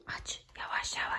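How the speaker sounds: tense whisper. Pretend. Sneaking up on the dragon.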